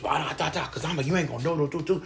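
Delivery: high, blustering mock bravado; mock big talk. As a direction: imitate puffed-up big talk in nonsense syllables. Mimics bold talk among themselves.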